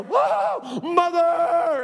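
high, drawn-out yell of "mother"; comic, hammed-up holler of pain; retold pain yell. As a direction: yelp it like you just stubbed your toe. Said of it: "I just yell".

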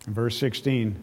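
ordinary talk, nothing marked

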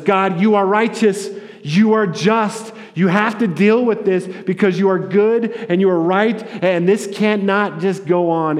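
loud, high impassioned plea; crying out. Acted. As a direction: cry out to God demanding justice. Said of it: "it cries and says".

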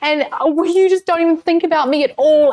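high-pitched re-enacted tantrum; hammed-up, aggrieved outpouring; childish ranting. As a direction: rant it out like an upset little kid. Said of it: "I went on this big rant".

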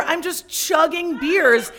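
normal talking voice

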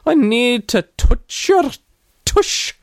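slow, high, hammed-up Scottish wail; Scottish accent. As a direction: cry out in an exaggerated Scottish brogue. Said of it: "in your best Scottish accent".

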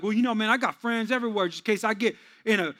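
lightly voiced bragging tough guy. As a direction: brag like a wannabe street guy. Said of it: "some people that be like".

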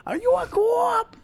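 put-on high, drawn-out Boston accent. Echoing a friend's commercial quote, high Boston accent. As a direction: stretch it out in squeaky mock Bostonian. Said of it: "I love Bostonian accents".

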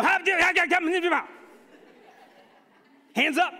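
loud, high garbled yelling; wordless panicked shouting. Retold or imitated. Dad with no words for his children, panicked shouting. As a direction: splutter frantic noises at the kids, very loud. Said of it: "make noises at your children 'cause you don't have words".

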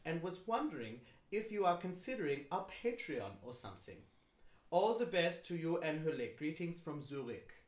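put-on amateur German accent, reading aloud; bad German accent. Host reading a fan's email, bad German accent. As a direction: read it out as a badly imitated German. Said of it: "if I do this with a bad German accent".

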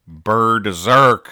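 "bird-zerk" stretched, loud, "bird" clearly heard; punning bird-zerk. Retold or imitated. Host delivering a comic's punchline, "bird-zerk" drawn out. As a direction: punch the pun "bird-zerk" in two slow parts. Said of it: "Bird-zerk instead of berserk".

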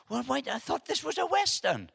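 high-pitched puzzled complaint; retold disbelief, pitched well up; baffled protest. Preacher recalling his confusion in the cinema, baffled.

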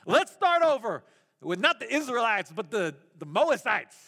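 ordinary delivery

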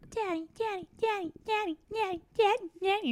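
high, repeated whining for daddy; whiny kid voice. Retold or imitated. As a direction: pester like a small child. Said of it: "Be like".

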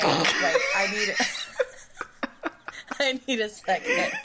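helpless giggly words; trying to recover from a laughing fit; crying with laughter. Real, not acted. Asking friend for a moment, crying with laughter. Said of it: "I'm crying".

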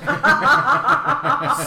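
loud, high burst of laughter; real belly laugh at his own story; big laugh. Real, not acted. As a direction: crack up out loud.

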